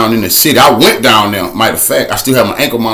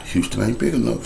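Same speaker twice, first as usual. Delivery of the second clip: much softer, lower ominous refrain; hushed refrain; 4 semitones lower, 12 dB quieter than usual. Acted. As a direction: sink the line to a low hush. Repeated refrain.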